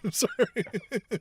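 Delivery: laughing through a slow apology; can't stop laughing while saying sorry. Real, not acted. Co-host apologising to the imagined wrestler, laughing.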